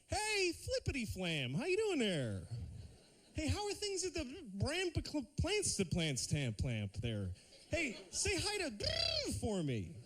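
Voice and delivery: high, slow, confidently nonsensical greeting; totally off greeting. Retold or imitated. Greeting a forgotten acquaintance with confident nonsense. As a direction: greet them with cheerful gibberish, slowly. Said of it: "just go up to him and go".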